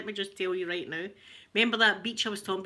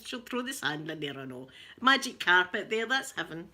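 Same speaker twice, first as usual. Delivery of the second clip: ordinary delivery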